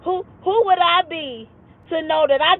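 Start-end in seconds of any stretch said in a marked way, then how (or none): none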